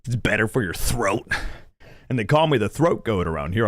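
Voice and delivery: usual speaking voice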